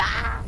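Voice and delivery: sudden high yelp of fright; real scream of shock; startled scream. Real, not acted. Streamer jolted mid thank-you, startled scream. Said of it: "That gave me a heart attack".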